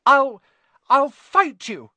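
high, loud, halting threat; desperate defiance. A narrator's reading. Neville blocking the portrait hole, desperate threat. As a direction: stumble into a high, slow threat. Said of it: "to do something desperate".

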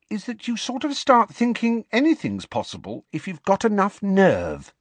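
put-on high-pitched girl musing; Ginny, thoughtful. A narrator's reading. Ginny encouraging Harry about having nerve, thoughtful. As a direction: build a point in a high girl's voice. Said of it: "said Ginny thoughtfully".

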